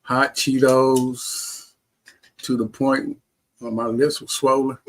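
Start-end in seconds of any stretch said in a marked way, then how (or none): 0.0-1.7 hot Cheetos stretched out very slowly, a bit louder; drawn-out craving. Real, not acted. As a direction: linger on hot Cheetos like you still want them. Recalling craving after transplant.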